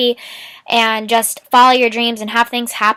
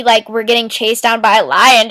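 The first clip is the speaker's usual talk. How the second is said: normal talking voice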